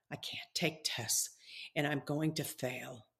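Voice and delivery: defeated whisper, hushed and unvoiced; worried whisper. Shown as a demonstration. Preacher showing a student's fearful self-talk, in a whisper. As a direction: whisper the fear under your breath. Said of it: "Instead of focusing on".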